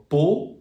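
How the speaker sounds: POT said the French way, silent T, closed OH; slow French "pot" with a rounded OH, rising. Shown as a demonstration. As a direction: draw out "pot" as a pure OH. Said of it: "the closed O".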